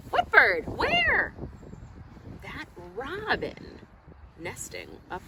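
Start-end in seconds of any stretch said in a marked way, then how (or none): 0.1-1.3 louder, rising higher and higher; higher, louder mock surprise; surprised searching. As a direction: look around puzzled, asking the kids. Reader acts searching for the bird.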